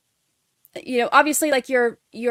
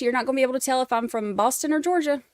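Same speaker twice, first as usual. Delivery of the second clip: ordinary talk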